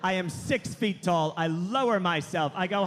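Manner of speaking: normal talking voice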